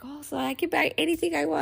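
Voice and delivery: high, quick imitation of an excited kid; excited son. Mother imitating her son told about the money, excited. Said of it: "he gets all excited".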